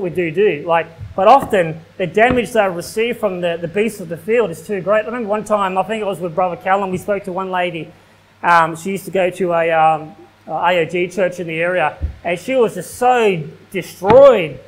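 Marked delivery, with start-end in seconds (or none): none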